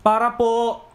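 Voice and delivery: calling out. Retold or imitated. Repeated call to unheeding driver.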